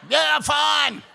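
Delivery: very loud, high yell; shouting back. Retold or imitated. Storyteller answering dog-faced dad, shouting back. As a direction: yell it back in a strained panic. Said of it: "I shouted back".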